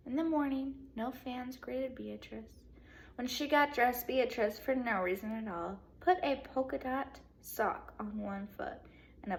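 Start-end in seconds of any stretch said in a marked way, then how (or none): none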